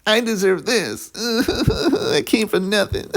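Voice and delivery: high-pitched, drawn-out mock whine; exaggerated whiny impression with fake blubbering; whiny mock wrestler. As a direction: whine it like a crybaby, dragging the words. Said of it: "very whiny, like".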